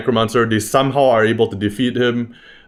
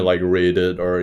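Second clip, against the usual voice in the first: ordinary delivery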